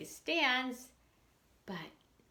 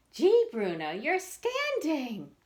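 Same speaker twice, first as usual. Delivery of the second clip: amazed; 6 semitones higher, 5 dB louder than usual. Acted. Peter amazed to see his cat standing, wonder-struck.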